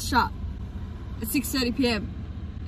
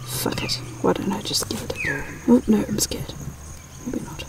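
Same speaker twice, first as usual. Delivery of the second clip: hushed bird daring itself; whispered magpie thoughts; 11 dB quieter than usual. Pretend. Vlogger whispering a magpie's nervous thoughts.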